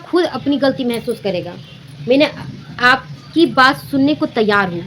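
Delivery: normal talking voice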